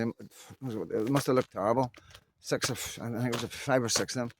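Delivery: ordinary talk, nothing marked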